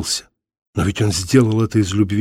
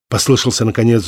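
ordinary delivery, nothing marked

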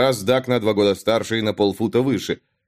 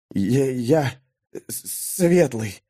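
strained whisper; much slower than usual. A narrator's reading. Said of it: "зашептал паренёк натужно".

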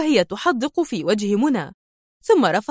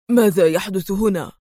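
ordinary delivery

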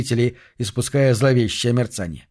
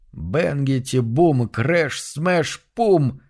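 drum sound effects; much slower than usual. Acted.

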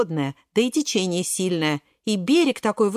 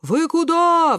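high, raised-voice cry; wailing yell; 9 semitones higher, 5 dB louder, slower than usual. A narrator's reading. Кабачок calling to the runners, high wailing yell. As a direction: yell after them, pitched up. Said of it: "вопит Кабачок".

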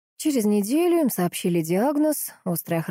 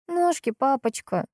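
put-on higher, slow little-girl voice; 6 semitones higher, slower than usual. A narrator's reading. Sick daughter answers her father, little girl voice. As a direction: answer like a small child to daddy.

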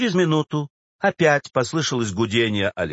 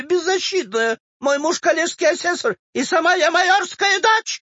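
very high, squealing complaint; aggrieved old woman; 12 semitones higher than usual. Acted. Old woman complains to the official, aggrieved. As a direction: pour out the grievance, pitched very high.

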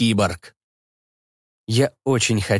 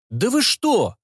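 panicky mechanic; 11 semitones higher than usual. A narrator's reading. Slesar protests, high voice.

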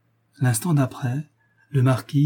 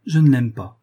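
assured and emphatic, louder; self-assured; 6 dB louder than usual. A narrator's reading. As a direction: declare it with full confidence. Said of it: "dit Lucile avec assurance".

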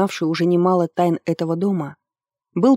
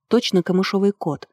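ordinary delivery, nothing marked

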